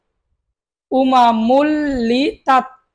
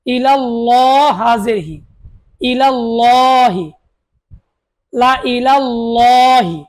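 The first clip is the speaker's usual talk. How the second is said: slow recitation; much slower than usual. Shown as a demonstration. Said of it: "আমার সাথে আপনারা বলুন".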